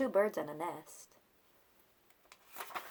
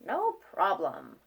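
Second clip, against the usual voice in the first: ordinary delivery, nothing marked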